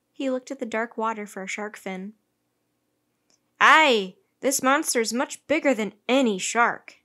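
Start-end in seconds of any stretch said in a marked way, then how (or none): 3.6-6.9 loud sailor's shout; forceful, loud "Aye" and on. A narrator's reading. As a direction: shout it out like a hearty seaman. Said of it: "shouted Joe".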